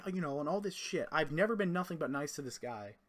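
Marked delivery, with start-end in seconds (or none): none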